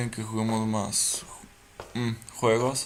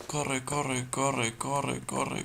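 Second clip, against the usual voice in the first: ordinary delivery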